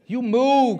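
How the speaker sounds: loud, high, fervent preaching climax; rising revival preacher; preaching at full force. Acted. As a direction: drive the congregation to move, slow and loud.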